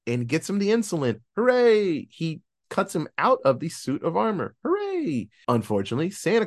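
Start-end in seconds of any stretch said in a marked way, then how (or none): 1.3-2.1 sarcastic little cheer; mock cheer. Real, not acted. 4.6-5.3 Hooray! squeaked much higher; high mock cheer. Real, not acted. Repeated cheer, pitched higher.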